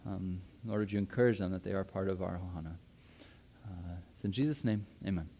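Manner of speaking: soft, reverent prayer voice; quiet prayer. Acted. Pastor praying over his congregation, soft and reverent. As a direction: pray gently over the church.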